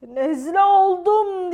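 slow, loud lion roar. Acted. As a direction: roar it out, drawing the words out. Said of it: "diye kükredi aslan".

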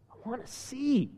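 softly retold plea, under the breath; soft request. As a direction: voice the request softly, without pushing.

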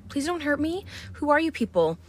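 put-on high, pleading, frightened girl's voice; scared girl. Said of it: "she's like".

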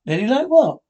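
startled, pushing for an answer; alarmed. Acted. Husband startled by a noise, alarmed question.

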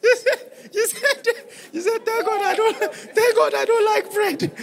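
retelling the wife's joke laughing; real giggling retelling. Real, not acted. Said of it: "before I laugh for you".

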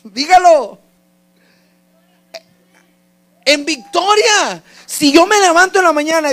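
loud, high pulpit exhortation; ringing, high-pitched preacher's shout; impassioned preaching. Real, not acted. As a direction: call it out to the whole church. Preaches at full force.

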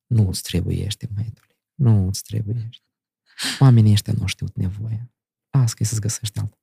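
a hint of an old grandmother gently warning off the boy; grandmother advising softly. Grandmother voiced in story.

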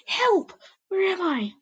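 high, loud panicked cry; frightened yelp. A narrator's reading. Lost apprentice waking in a dark forest, high panicked yelp. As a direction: yelp out in fear. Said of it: "I yelped".

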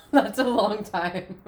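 laughing. Real, not acted. Reacting to friend's seven hard months, laughing. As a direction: laugh it out in sympathy.

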